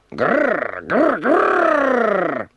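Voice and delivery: rolled high growl, "grrr"; boy's mock roar. A narrator's reading. Sudi roaring back at the tiger, mock growl. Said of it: "E Sudi di rimando".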